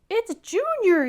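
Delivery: high, loud, thrilled exclamation; joyful storybook yell; excited shout. Acted. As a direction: shout it like a delighted new father. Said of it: "he shouted".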